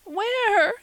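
high, loud tearful cry; sobbing. Acted. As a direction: wail the word through tears. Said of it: "sobbed Carrie Sloane".

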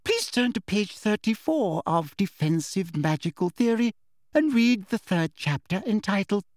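high, prim, sugary instruction; singsong the assignment with sweet condescension; smug teacher. A narrator's reading. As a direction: precise high schoolmistress voice. Smug teacher sets reading.

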